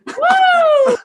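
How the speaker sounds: high shrieking witch laugh; witch cackle. Real, not acted. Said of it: "You made me witch cackle".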